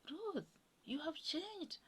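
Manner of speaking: high, soft voice of mild astonishment; imitated amazement. Congolese housemate notices Ruth has changed, high imitated amazement.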